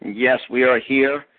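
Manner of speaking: ordinary delivery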